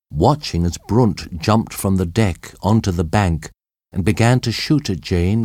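ordinary delivery, nothing marked

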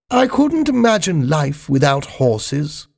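put-on high, genteel old lady voice; the Queen. The Queen offering her saddle to Spiggy, high genteel voice.

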